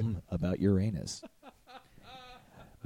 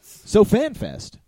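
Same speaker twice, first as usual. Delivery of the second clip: excited; 12 semitones higher, 6 dB louder, much slower than usual. Real, not acted. Announcing the event.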